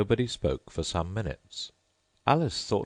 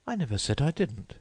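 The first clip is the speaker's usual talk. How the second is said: put-on much higher polite girl voice; Alice's voice; 9 semitones higher than usual. A narrator's reading. Alice thanking the Mock Turtle, high and polite. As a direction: pitch up into a polite young girl.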